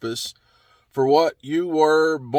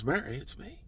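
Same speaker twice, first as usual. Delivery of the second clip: much softer, intimate and tender; tender reveal; 13 dB quieter than usual. Acted. Risen Jesus gently revealing himself to Mary, very soft. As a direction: say her name like a quiet embrace. Jesus speaks her name.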